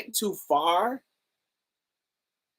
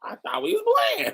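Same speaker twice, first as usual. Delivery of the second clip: much higher, fast puzzled voice; baffled uncle. Retold or imitated. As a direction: play the confused uncle, high and quick. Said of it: "he was like".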